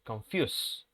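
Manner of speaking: "confuse" ending on an unvoiced s, konfjus; final s in confuse. Shown as a demonstration. Teacher showing the last sound. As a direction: end "confuse" with a hissed s. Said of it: "una S".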